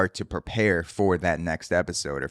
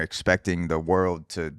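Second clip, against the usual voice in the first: ordinary talk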